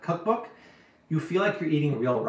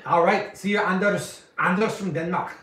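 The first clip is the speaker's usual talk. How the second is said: lightly put-on Danish accent, pitched higher; mock Danish accent; 4 semitones higher than usual. Host imitating a Danish viewer's accent for fun. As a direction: say the name as a Dane might, playfully. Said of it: "Am I talking more Danish?".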